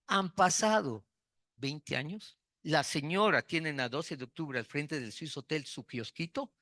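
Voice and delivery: ordinary talk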